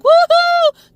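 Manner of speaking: high, loud party cheer; partying whoop. Retold or imitated. Drunk swimmers cheering from the water, a loud whoop. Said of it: "and they're like".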